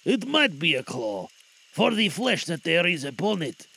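ordinary delivery, nothing marked

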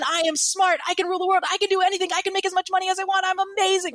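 imitating a seminar high; euphoric self-talk. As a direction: crow like you can rule the world. Post-event euphoria, retold.

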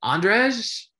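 high, loud quizzical Andrej; mock-quizzical high voice; mocking mispronunciation. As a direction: squeak it out as a hesitant question. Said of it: "he'll go".

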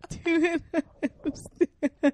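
slow, hammed-up whimper; mock crying. Mocking the boyfriend sulking over her rating, in mock crying. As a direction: drag it out like a fake sob. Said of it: "curl in a ball, crying".

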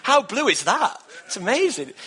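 thanking God for the sky; excited praise. Retold or imitated. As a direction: marvel out loud at the blue sky. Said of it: "And I thought".

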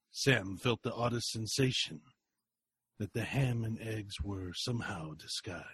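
soft, low storyteller; hushed narration. A narrator's reading. Narrator setting the eerie scene, hushed. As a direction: lean in and murmur the story.

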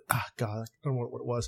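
ordinary delivery

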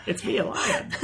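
giggling lion voice, playful; bouncy playful with animal noises. Pretend. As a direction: laugh through a silly lion bit. Playing a lion, laughing.

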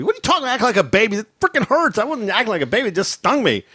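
high, indignant protest to mom. Retold or imitated. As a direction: protest you're not a baby. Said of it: "reply to mom".

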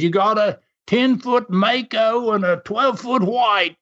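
high-pitched mock loudhailer announcement; imitated helicopter announcer. Helicopter man warning the beach towers, imitated microphone announcement. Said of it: "he gets on the microphone".

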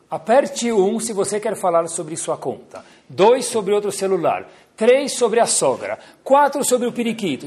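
high, sing-song automated menu; phone menu voice. Retold or imitated. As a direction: recite it like a recorded phone menu. Mocking the phone hold menu.

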